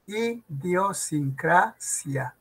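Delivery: Idiosincrasia very slowly, i-dio-sin-CRA-sia; syllable by syllable. Shown as a demonstration. Teacher dictating for an exam, Idiosincrasia syllable by syllable. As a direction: spell out Idiosincrasia in separate, measured syllables. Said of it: "es idio".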